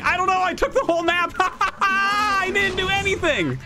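high-pitched gloating parody with a cackle; mock him in a silly high voice; mocking opponent imitation. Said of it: "he's just like".

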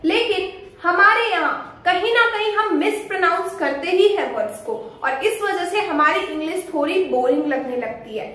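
ordinary talk

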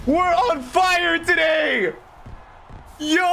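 over-the-moon gamer yell; frat bro. Real, not acted. Pulls Zidane from icon pack.

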